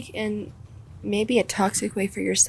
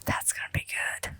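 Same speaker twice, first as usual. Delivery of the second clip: whispering; 7 dB quieter than usual. Real, not acted. Waiting for neck crack.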